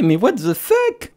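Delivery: high, slow disbelief; incredulous. Real, not acted. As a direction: stretch out your disbelief, way up high. Outraged at poll result. (incrédule, aigu et lent)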